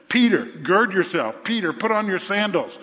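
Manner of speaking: ordinary delivery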